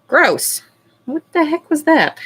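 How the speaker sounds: high, loud disgusted surprise; grossed out. Real, not acted. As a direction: yelp of disgust.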